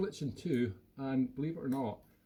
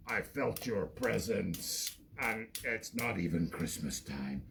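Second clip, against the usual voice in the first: lower, slow, solemn movie voice; mock Jedi voice; 5 semitones lower, slower than usual. Puppet confronts his old master, slow solemn mock voice. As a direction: intone it like a grave film villain. Obi-Wan puppet battles Vader.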